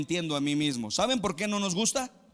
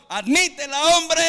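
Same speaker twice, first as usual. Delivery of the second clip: higher, louder command to admit it; urgent exhortation; 11 semitones higher, 8 dB louder than usual. Acted. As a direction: push them hard to admit it. Preacher urges congregation. (exhortación fuerte y urgente)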